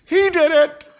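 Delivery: high, loud squeal pinning the blame; shrill it out like she's pointing a finger; mock accusing woman. Pastor imitates the lady accusing him to the policeman.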